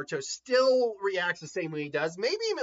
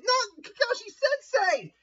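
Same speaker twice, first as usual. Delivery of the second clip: shocked cry, very high and drawn-out; acted anguished yell; Naruto; slower than usual. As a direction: cry out in stretched, high-pitched horror. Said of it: "Naruto is shocked to see that".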